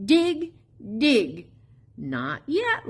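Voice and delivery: ordinary delivery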